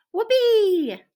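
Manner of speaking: very high, loud joyous cheer; thrilled whoopee. A narrator's reading. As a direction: explode with puppy glee. Dog thrilled about car ride.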